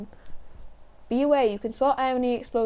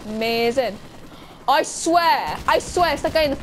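normal talking voice

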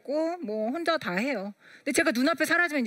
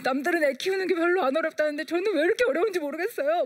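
tearful plea, pitched up and pushed out through crying; weeping confession of helplessness; 6 semitones higher than usual. Retold or imitated. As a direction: pour it out to the pastor, half sobbing. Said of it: "막 울면서".

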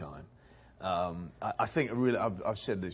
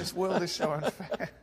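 mock indignant whine; 10 semitones higher, 4 dB louder than usual.

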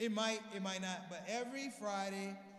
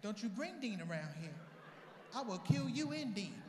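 outraged mom. Retold or imitated. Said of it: "She's like".

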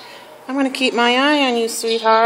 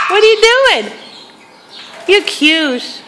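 pet talk; 11 semitones higher than usual. Real, not acted. Cooing to a cute shelter dog, much higher baby talk. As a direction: squeal it sweetly at the dog.

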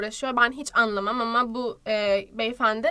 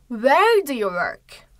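slow model phrase; 6 dB louder, much slower than usual. Shown as a demonstration. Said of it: "dialogue model read slowly".

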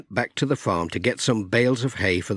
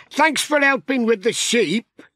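raised cheerful character voice; Alf's voice; 11 semitones higher than usual. Acted. Alf thanking Pat, raised and cheerful. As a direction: thank him high and bright.

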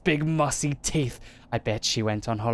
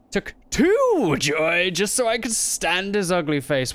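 slow, raised, bitter reading of a paranoid diary; 6 semitones higher, 4 dB louder, slower than usual. Acted. Reading a madman's diary aloud to viewers, slow and bitter. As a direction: spit it out slowly, hating him.

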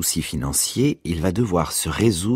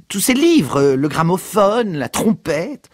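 higher, louder listing of purchases; mother listing son's spending, higher; exasperated mother; 13 semitones higher, 5 dB louder, slower than usual. A narrator's reading. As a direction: tick off his purchases indignantly.

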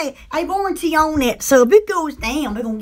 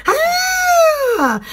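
much higher yelped ah; high shout ah; 11 semitones higher than usual. Retold or imitated. Imitating the fan noise, high yelp. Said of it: "You just go".

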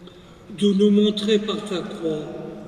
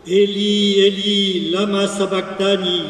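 raised voice, held on an even pitch; loud cry; 2 semitones higher, 4 dB louder than usual. A narrator's reading. Gospel reader voices Jesus on the cross, loud cry. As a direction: cry out solemnly, without screaming. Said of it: "Jésus cria d'une voix forte".

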